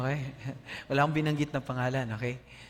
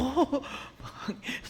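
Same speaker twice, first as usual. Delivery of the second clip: nervous laugh; 10 semitones higher than usual. Retold or imitated. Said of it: "ikaw ang mag-lead ng prayer mamaya".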